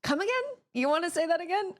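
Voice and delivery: squeaky, disbelieving come again; baffled shopkeeper. Retold or imitated. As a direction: act out a clerk who can't believe his ears. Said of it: "the guy's like".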